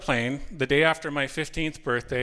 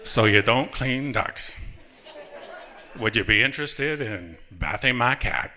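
Morgan Freeman; 3 semitones lower than usual. Acted. Customer innocently asking telemarketer about bathing his cat, Morgan Freeman impression. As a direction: stretch the words out, unbothered. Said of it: "maybe Morgan Freeman or something like that".

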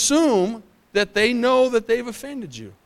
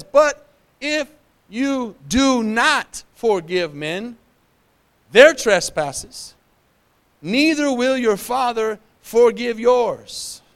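slow solemn scripture; 4 semitones higher, much slower than usual. Acted. Pastor warns the church with Jesus' words, slow and high. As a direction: warn them through the verse, word by word.

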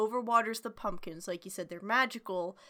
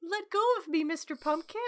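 put-on high, giggly singsong of a silly girl; carefree girl; 9 semitones higher than usual. Barbara giggling at the killer pumpkins, high and singsong. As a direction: prance about like a careless child. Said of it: "she's just like".

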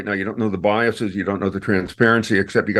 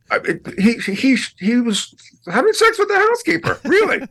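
laughing disbelief at the affair; incredulous; 14 semitones higher than usual. Real, not acted. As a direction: squeal it in amazed disbelief.

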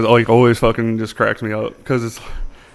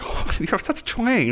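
imitated cousin; 10 semitones higher, 7 dB quieter than usual. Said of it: "my cousins, she's like".

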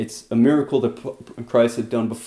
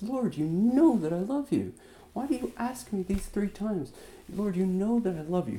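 higher, pleading and distressed; 9 semitones higher, 6 dB quieter than usual. Acted. Peter pleading with Jesus, higher and distressed. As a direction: beg him to stop asking, higher. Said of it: "Peter gets distressed".

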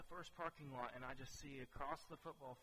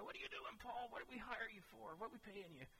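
high-pitched imitation of scolding bosses; mock scolding; 8 semitones higher than usual. Imagined staff berating Paul over spilled paper, mock scolding. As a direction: put on a high voice to tell off an employee.

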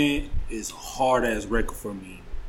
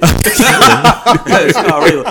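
laughing; 4 semitones higher, 9 dB louder, slower than usual. Real, not acted. Laughing while repeating joke.

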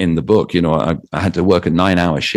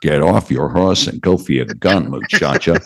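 bad American cowboy accent, John Wayne style. British DJ showing his hopeless American accent, John Wayne impression. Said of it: "end up sounding like John Wayne".

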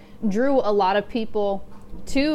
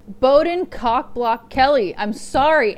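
normal talking voice

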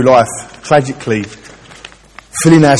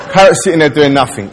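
louder, laughing while telling; laughing through it; 3 semitones higher, 4 dB louder than usual. Real, not acted. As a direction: chuckle through the words. Laughing at own joke.

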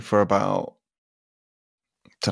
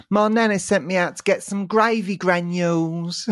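high-pitched mimicked kid voice; imitating little boy; 7 semitones higher than usual. Retelling how the boy said it, high little-boy voice. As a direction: mimic a small lad, pitched up. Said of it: "this is how he said it".

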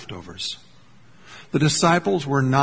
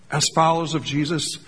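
normal talking voice

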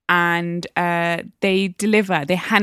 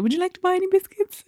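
polite tea rep; 11 semitones higher than usual. Retold or imitated. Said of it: "they're like".

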